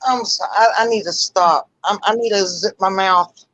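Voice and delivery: ordinary delivery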